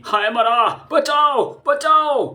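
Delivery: high panicked yelling; terrified shouts for help; cries for help. Acted. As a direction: scream for help as the dog bites. Thug attacked by dog.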